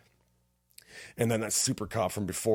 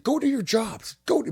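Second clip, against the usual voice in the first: barked order; 5 dB louder than usual. Retold or imitated. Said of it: "he's like".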